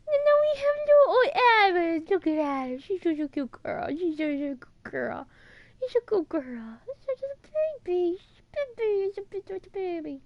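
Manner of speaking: put-on high, squishy cooing baby voice; gushing baby talk. Fan dotes on little old Abby, gushing baby talk. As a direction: coo as if to an adorable infant.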